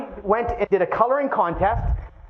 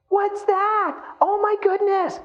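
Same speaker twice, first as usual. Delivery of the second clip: surprised; 11 semitones higher, slower than usual. Acted. Acting friends surprised at fridge.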